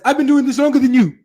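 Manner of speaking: fast, high-pitched boastful mimicry; Zakir Naik, intimidating. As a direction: rattle it off high to intimidate a challenger. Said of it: "intimidate the brother".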